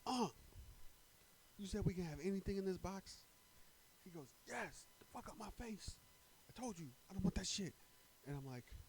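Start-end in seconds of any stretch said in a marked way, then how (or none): 0.1-3.2 high, louder careful question; cautious double-check. Retold or imitated. Asking uncle again nervously. 4.5-7.7 irritated uncle. Retold or imitated.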